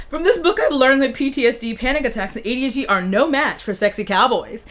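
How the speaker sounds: ordinary delivery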